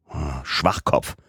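slow, mildly dismissive sigh of contempt; scornful insult. A narrator's reading. Agent dismisses the gullible servant, scornful. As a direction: drag out the insult slowly.

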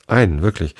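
plain reading voice

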